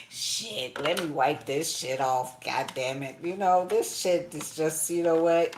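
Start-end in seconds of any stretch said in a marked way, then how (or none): none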